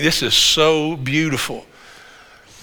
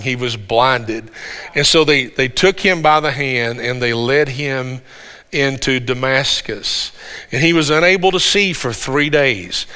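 ordinary delivery

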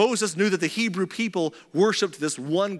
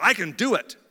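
put-on confident, ready young man; firm, eager can-do voice; young Moses; 3 semitones higher, 3 dB louder than usual. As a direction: declare it with full self-belief. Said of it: "Moses, the young man, was ready to deliver".

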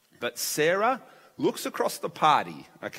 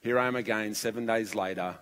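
soft, lowered voice in worship; prayerful wonder; 3 semitones lower, 5 dB quieter than usual. Retold or imitated. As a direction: quietly confess to God in awe. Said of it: "I worship God along with you".

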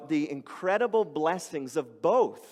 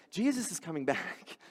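quiet aside; 8 dB quieter than usual. Retold or imitated. Paul voiced as quiet aside.

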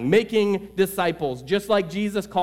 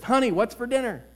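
calling out; 8 semitones higher than usual. Retold or imitated. Husband calls from closer.

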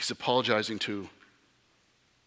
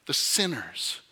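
ordinary delivery

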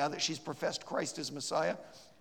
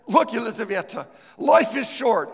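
high, loud urgent plea; pleading; 8 semitones higher, 16 dB louder than usual. Retold or imitated. The preacher begs her to believe, high and loud. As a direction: beg her with everything. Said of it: "I began to beg her".

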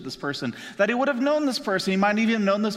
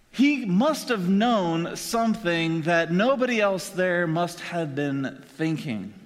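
usual speaking voice

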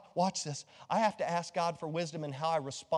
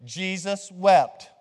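louder and slower, every word drawn out; louder slow recitation; 6 dB louder, much slower than usual. Shown as a demonstration. Pastor checking the congregation got it, louder and slow. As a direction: call it out big for the room to repeat. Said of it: "I wanna make sure you're, you're good".

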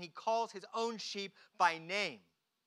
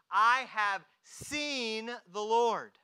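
loud, very slow, drawn-out declaration; emphatic proclamation; 6 dB louder, much slower than usual. Retold or imitated. Mary tells the disciples what she saw, proclaiming. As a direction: proclaim it word by word, stretched out.

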